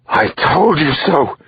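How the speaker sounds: high, slow, drawn-out scream. Acted. Wife screaming at her husband after the news, high slow scream. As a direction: hurl it at the husband in rage. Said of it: "she screamed at her husband".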